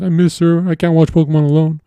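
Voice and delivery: high, loud whiny mock lament; mock crying. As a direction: wail it like a lovesick simp. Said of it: "usually he'd cry and be like".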